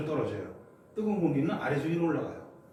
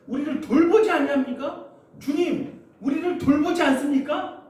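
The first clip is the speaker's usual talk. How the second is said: higher, louder reproach, 돌보지 rising hard; angry plea; 6 semitones higher, 5 dB louder than usual. Acted. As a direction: demand an answer from the Lord in anger. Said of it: "아니면 화내는 거예요". (원망하며 화내듯이)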